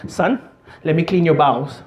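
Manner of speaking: imitated Indian dad; father to son, strong Indian accent. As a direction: say it earnestly in a thick Indian accent. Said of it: "thick Indian accent".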